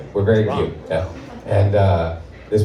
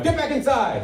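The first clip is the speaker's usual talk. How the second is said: sudden pitched-up yell to the hallway; mock yell; 14 semitones higher than usual. Husband yelling at wife to hide. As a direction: bark it out as a pretend panic. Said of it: "I yell at my wife".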